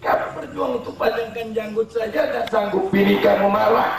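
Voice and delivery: high, laughing scorn; mocking sermon. Real, not acted. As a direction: ridicule the timid men. Fiery preaching, mocking.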